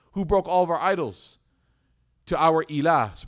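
loud, indignant demand; outraged cry. Retold or imitated. Worshippers demanding who broke their idols, loud and outraged.

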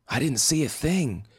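high, stunned and panicky; shocked. A narrator's reading. Bitten surfer in shock.

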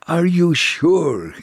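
high, slow doubting question from the rabbi; rabbi asking. Retold or imitated. Rabbi doubts the soul is alive, asks slowly high. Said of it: "he asked".

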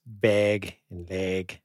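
"bag" and "leg" with Minnesota vowels; slow Minnesota-accent vowels. Retold or imitated. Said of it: "my friend, uh, Dee Olson, everything is".

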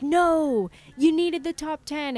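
loud, high burst of eager protest; a fan shouting that they deserved it; eager supporters. Retold or imitated. Supporters flooding her phone, loud and eager. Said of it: "all these people being like".

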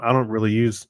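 ordinary talk

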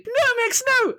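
very high squeaky protest; pleading little lizard. Acted. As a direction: squeal a plea in a tiny creature voice. Lizard pleads while dodging a grab.

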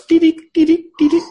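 high, quick electronic beeps; imitated alarm beeps. Friend imitating the house's yell-at-dad alarm, high beeping. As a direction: mimic a little alarm going off. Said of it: "they got a little alarm somewhere".

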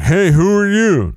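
leaning out of the car to hail strangers, high call. Pretend. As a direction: shout it out the car window. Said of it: "and been like".

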